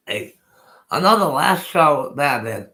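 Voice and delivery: ordinary delivery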